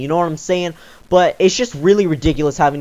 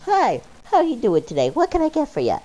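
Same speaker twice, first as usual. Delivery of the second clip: much higher, sing-song customer service greeting; cheery order-taking voice; 9 semitones higher, 4 dB quieter than usual. Retold or imitated. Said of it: "the typical Tristan order-taking style".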